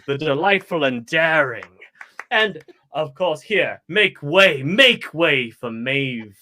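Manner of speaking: theatrical master of ceremonies; grand showman's introduction; dramatic entrance. Acted. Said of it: "making a dramatic entrance, and say".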